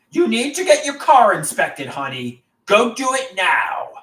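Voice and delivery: high, loud bossing order; bossy girlfriend. Pretend. Pretend girlfriend ordering Andrew to inspect his car, loud and bossy. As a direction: boss him around like a nagging girlfriend. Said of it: "she'll boss me around".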